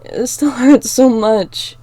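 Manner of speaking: slow, loud tearful laugh; half-laugh while still crying; laughing through tears. Acted. Crying then laughing wetly.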